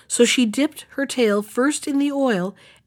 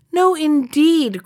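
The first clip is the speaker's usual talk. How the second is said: slow, high, louder protest; crying out; 7 semitones higher, 4 dB louder, slower than usual. Acted. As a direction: drag out the protest. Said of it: "cried the mouse".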